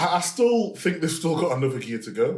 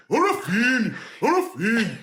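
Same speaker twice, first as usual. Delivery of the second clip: high, swooping mock Brazilian accent; exaggerated Brazilian pronunciation, said twice over; 6 semitones higher than usual. Shown as a demonstration. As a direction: ham up the name in a Brazilian accent. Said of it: "But in Brazilian".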